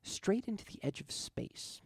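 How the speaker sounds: usual speaking voice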